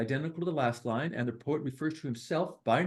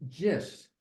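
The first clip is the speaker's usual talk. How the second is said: ordinary delivery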